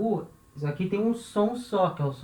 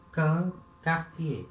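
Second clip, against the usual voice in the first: slow recap; much slower than usual. Shown as a demonstration. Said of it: "som de K".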